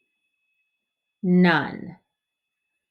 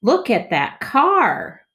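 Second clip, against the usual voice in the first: higher, slow, full of wonder; excited reading; 5 semitones higher, 3 dB louder, slower than usual. Shown as a demonstration. Teacher models excited smooth reading for kids. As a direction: show how exciting it is. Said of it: "with excitement".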